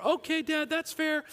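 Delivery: put-on very high kid voice; young son. Son accepting dad's one year deal, high kid voice. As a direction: put on a little boy agreeing.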